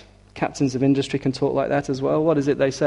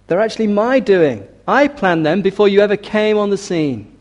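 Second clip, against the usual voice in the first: high, louder pulpit proclamation; raised, insistent preaching voice; emphatic divine claim; 6 semitones higher, 5 dB louder than usual. Acted. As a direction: claim the credit forcefully as God. Voicing God's rebuke to proud king.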